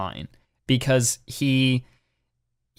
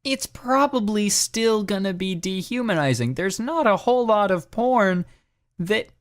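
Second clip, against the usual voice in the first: higher, heated rant; 9 semitones higher than usual. Real, not acted. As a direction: push the pitch up in exasperation. Ranting against elitism.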